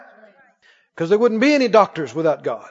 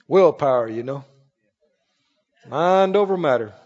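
self-crediting worldly person; 8 semitones lower than usual. Acted. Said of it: "They'll say".

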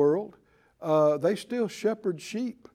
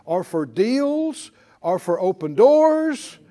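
repeated loud confession; 7 semitones higher, 8 dB louder than usual. Shown as a demonstration. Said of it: "Say it twice more".

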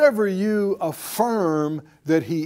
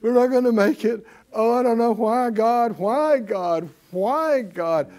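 higher-pitched, hammed-up wail of woe; mock despairing complaint; 5 semitones higher than usual. Preacher mimics someone doubting God, mock despairing complaint. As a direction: imitate a hopeless complainer, drawing out the whys.

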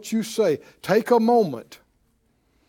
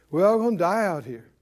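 mock voice of people mouthing what they were fed; puppet-like repeating; 3 semitones lower, faster than usual. Preacher imitates people open their mouth and repeat it, voiced aloud. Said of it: "and they say".

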